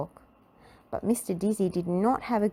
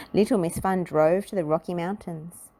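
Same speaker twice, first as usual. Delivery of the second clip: plain reading voice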